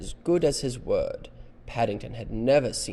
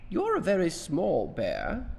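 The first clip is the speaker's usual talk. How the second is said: higher storybook lady voice; high-voiced woman; 9 semitones higher, 4 dB louder than usual. Missus Brown addresses the bear, high-voiced. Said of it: "this is the woman with the high voice".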